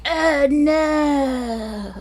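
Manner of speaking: drawn-out, high "oh no" of dismay; high-pitched comic dismay; mock dismay. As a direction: wail it in mock horror, holding the vowel. Acting out a reaction mid-story.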